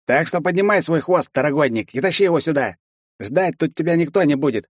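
high, barking orders; bossy guard. A narrator's reading. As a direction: bark the order down at him.

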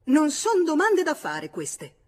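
sharp outburst, high and loud; loud, high-pitched snapping retort; brusque. A narrator's reading. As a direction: burst out in a brusque, indignant rebuke. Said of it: "disse brusca".

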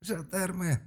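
high, incredulous echo; amused scoffing. A narrator's reading. Malk scoffs at Gverd's guess, amused scoffing. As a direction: throw the word back mockingly, pitched high. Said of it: "засмеялся Малка и цыкнул зубом".

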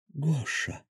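choked up at the toy; voice trembling. A narrator's reading. Anya finds her old unicorn, voice trembling. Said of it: "её голос дрогнул".

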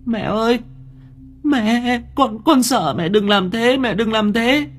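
put-on high, desperate child's plea; frightened, drawn-out high cries; pleading wail. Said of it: "gọi thống thiết".

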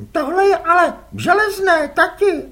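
put-on a boy asking innocently; curious boy. Les asks his father whose squid they are, boyish.

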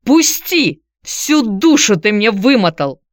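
very high, loud furious cry; angry cry. A narrator's reading. Ex-wife to Vladislav at the door, high furious cry. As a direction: scream at him to let go. Pushing past him angrily.

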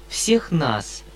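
ordinary delivery

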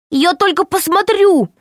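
high, trembling plea. A narrator's reading. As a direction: quaver it out, pleading. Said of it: "произнёс дрожащим голосом Пашка".

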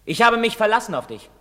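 high, forceful reproach; bitter reproach. Acted. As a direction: hurl your disappointment at him. Son reproaches his father.

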